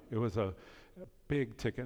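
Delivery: usual speaking voice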